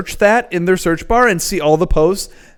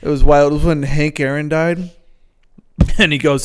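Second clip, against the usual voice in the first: ordinary delivery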